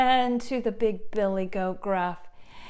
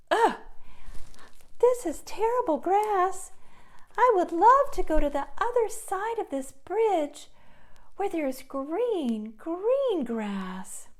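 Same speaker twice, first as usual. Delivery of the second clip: much higher, whiny goat voice; little goat complaining; 11 semitones higher than usual. Acted. Goat grumbling over brown grass, high and whiny. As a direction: whine about the yucky grass.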